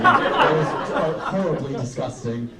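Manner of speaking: squeaky high then laughing; embarrassed laugh. Real, not acted. Apologising to the crowd, embarrassed laughing squeak. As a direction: laugh off the gross joke. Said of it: "sorry, that was disgusting".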